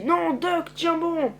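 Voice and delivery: high-pitched urgent plea; urgent alarm. Acted. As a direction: call out to keep someone holding on. Urgent call during zombie attack.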